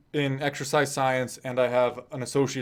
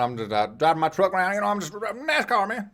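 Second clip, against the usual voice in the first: mock Southern accent; 7 semitones higher than usual. Shown as a demonstration. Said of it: "I can talk like a real Southerner".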